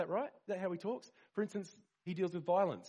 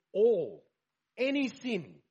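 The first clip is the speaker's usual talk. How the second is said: "All" called out much louder, "any sin" higher; loud emphatic proclamation; 7 semitones higher, 8 dB louder, slower than usual. Real, not acted. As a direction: proclaim it to the whole room. Preacher proclaiming Jesus' words.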